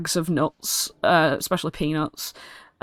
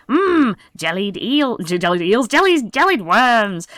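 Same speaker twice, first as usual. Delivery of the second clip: goblin hawker; 5 semitones higher, 3 dB louder than usual. Acted. Second goblin vendor.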